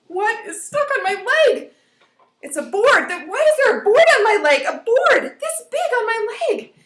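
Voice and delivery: put-on high, loud, alarmed kid; acted panic. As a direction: cry out in hammed-up disbelief. Child finds board on leg.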